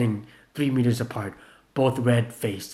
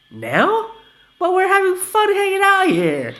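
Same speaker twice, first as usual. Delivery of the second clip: high, loud whiny protest; complaining; 9 dB louder than usual. Acted. Vanilla complaining about leaving the fun, high whiny voice. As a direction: whine it, much higher. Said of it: "Vanilla complained".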